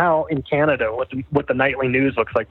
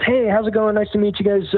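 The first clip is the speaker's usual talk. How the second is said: higher-pitched retold doctor's hello; doctor's friendly greeting; 8 semitones higher than usual. Doctor greets family in retold visit.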